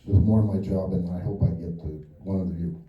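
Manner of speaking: ordinary talk, nothing marked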